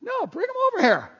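high-pitched, quick welcoming call; insistent calling over. Acted. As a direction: overrule them and call the kids in. Jesus calls the children over.

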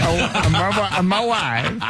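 high, laughing catchphrase parody; Borat. Acted. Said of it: "Bill Burr doing Borat".

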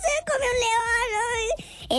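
very high, comic wailing lion; mock terrified scream. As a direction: scream as a lion fleeing in hammed-up terror. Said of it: "sale corriendo por toda la selva gritando". (grito agudo de pánico fingido)

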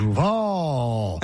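"Duval" flat and drawn out, low fall; wrong stress. Retold or imitated. Mocking the coach's mispronunciation of "Duval". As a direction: say "Duval" with a long level first part and a slow falling end. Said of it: "Instead, he went".

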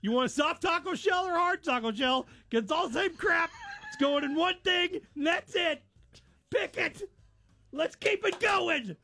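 high, shouting rant; mad rant. Real, not acted. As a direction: vent at the menu choice. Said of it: "I was so mad".

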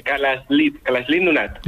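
"Kalaslit Nunat", double L pronounced as an S plus L. Shown as a demonstration. Guest corrects the hosts on the book's title, "Kalaslit" with SL. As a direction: say "Kalaslit" with an S sounded before the second L. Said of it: "le ponen una S y una L".

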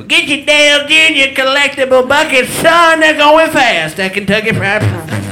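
high, loud hammed-up ad voice, slowing at the end; mock commercial announcer. Comic parodying a TV ad announcer, hammed-up pitchman. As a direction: belt it like a cheesy TV ad.

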